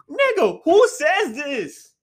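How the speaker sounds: high, loud, drawn-out disbelief; high-pitched exasperated outcry; incredulous outburst. Real, not acted. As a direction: stretch the question out in loud disbelief. Outraged reaction to crude lyric.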